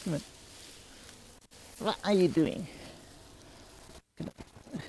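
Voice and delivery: "What are you doing?" in high cooing pet talk; cat voice. Real, not acted. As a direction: ask the cat sweetly, high. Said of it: "my cat voice".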